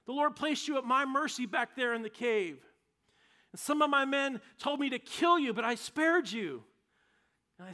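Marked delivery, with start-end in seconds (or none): 0.0-6.6 higher, louder, earnest plea; pleading shout. Acted. David shouting to Saul outside the cave, pleading loudly. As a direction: call out across the distance, pleading. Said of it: "he shouted to Saul".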